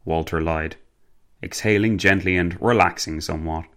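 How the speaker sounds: ordinary delivery, nothing marked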